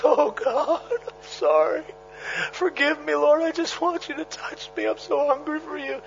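soft broken repentant prayer, voice high and small; tearful plea. Retold or imitated. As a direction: beg God softly through tears. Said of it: "he's crying like a baby".

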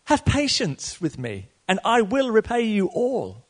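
high, slow begging plea; lightly voiced pleading servant. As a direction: beg the master for mercy. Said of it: "fell down before him, saying".

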